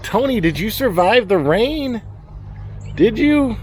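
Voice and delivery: high coaxing pet talk. Real, not acted. Farmer coaxing his goat Tony in pet voice. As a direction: coo at the goat.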